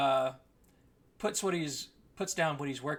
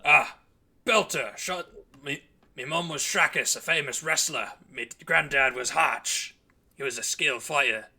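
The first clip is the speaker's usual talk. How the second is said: loud, boisterous wrestler's-son voice; big, loud greeting with dropped-vowel accent; hearty Belter; 8 dB louder than usual. Acted. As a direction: boom out a proud introduction. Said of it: "He goes".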